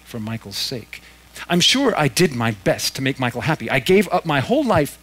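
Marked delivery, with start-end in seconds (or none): 1.5-5.0 aggrieved ghost mother. A narrator's reading. Ghost mother's self-pity.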